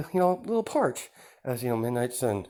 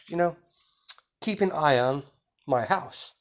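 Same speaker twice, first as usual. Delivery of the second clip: high, oily polite villain voice; a charming, sly host welcoming guests; smug villain host; 5 semitones higher than usual. Acted. Plays villain Izuku throughout.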